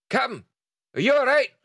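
high urgent crewman voice; calling out. Acted. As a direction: call through the door, worried. Crewman bursts in calling captain.

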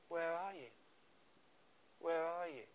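gentle seeking voice; tender call. Pretend. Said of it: "Jesus has been saying those words".

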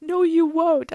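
high, loud panicky refusal; stressed protest. Retold or imitated. Stuck climber refuses dad's help, stressed protest. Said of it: "I'm, like, really stressed".

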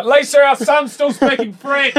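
high, loud, drawn-out mock cry; mock alarmed parent. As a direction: call out to a spouse in hammed-up panic. Acted parent alarmed at French-speaking son.

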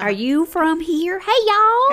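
high, loud, drawn-out Texan greeting; broad put-on Texan drawl; Texan accent. Shown as a demonstration. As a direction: holler like a hammed-up Texan. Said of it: "whip out the Texan accent".

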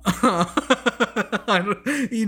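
laughing. Real, not acted. Laughs mid-sentence.

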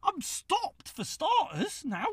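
high, slow indignant reply; retold indignant retort. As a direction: spell it out to the other driver. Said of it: "I'm like".

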